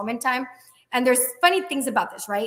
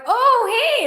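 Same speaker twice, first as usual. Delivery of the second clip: surprised high oh hey; 11 semitones higher, 7 dB louder than usual. Retold or imitated. [name] surprised the caller phoned again. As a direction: pitch jumps way up on a startled oh hey. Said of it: "and I'm like".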